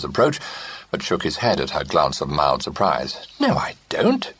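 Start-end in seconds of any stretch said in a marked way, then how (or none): 3.4-4.3 quick whispered protest. A narrator's reading. Nephew protesting to his great-aunt, whispered. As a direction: hurriedly deny it under the breath. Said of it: "he protested".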